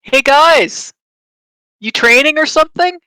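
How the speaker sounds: loud, high-pitched call; yelling out. Acted. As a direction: shout it across to people far off. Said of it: "and yell out".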